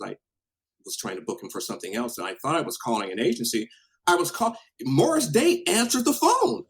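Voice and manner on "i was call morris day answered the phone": high, loud astonishment; incredulous, pitched way up and slowing; amazed. Retold or imitated. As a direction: tell the punchline in loud disbelief. Astonished who picked up the phone.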